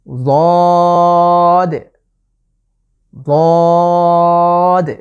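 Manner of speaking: বদ said thick and loud, tongue edge against the molars; drawn-out heavy বদ; Arabic letter dad. Shown as a demonstration. Teacher showing viewers the letter dad, a loud thick বদ. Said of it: "একটু মোটা করে উচ্চারণের চেষ্টা করব".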